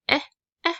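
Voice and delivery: short clipped eh, eh; short e sound. Shown as a demonstration. Teacher modelling the short E for her class. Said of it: "is our short E".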